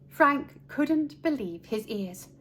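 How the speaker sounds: ordinary delivery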